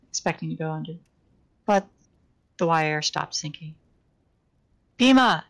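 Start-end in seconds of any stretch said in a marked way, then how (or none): 5.0-5.4 loud, high call into the dark; shout. A narrator's reading. Trapped man shouts for Bhima, loud and high. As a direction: shout the name, pitch dropping off at the end. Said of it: "he shouted".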